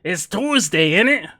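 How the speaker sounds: hammy attempt at a British accent; cheesy mock British voice; bad British accent. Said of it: "Attempt an accent".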